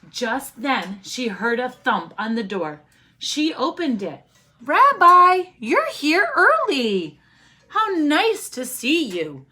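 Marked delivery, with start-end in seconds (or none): 4.6-9.4 high, bright welcome to the rabbi; cheery old granny. Acted. Old granny greeting the rabbi at her door, high and delighted. Baba Bryna greets the visitor.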